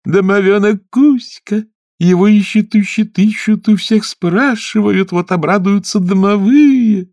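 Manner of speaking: high, slow, sing-song mermaid; gleeful high-pitched water maiden. Acted. Mermaid voiced, excited. (голос русалки)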